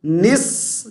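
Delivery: نس as "niss", with a long whistled s; joined noon and saad. Shown as a demonstration. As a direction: hold the s of نس after a short i. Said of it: "نون کو صاد کے ساتھ ملائیں گے".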